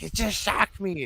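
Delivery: insisting the guitar bit him; hammed-up stunned bandmate; mock shocked guitarist. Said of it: "he's like".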